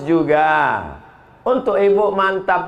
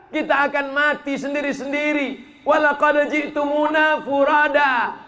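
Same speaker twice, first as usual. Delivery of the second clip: raised, forceful sermon proclamation; fiery preaching; 6 semitones higher than usual. Acted. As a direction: thunder the verse at the congregation. Preaching Quran verse with force.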